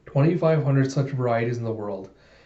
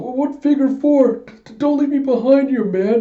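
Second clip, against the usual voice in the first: high, loud desperate plea; stammering, frightened cry; panicked calling out; 12 semitones higher, 5 dB louder than usual. Acted. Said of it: "Yorg calls out".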